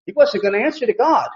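usual speaking voice

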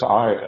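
"I" as a strong, stand-alone word; emphatic I. Retold or imitated. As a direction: punch "I," then pause. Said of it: "emphatically again".

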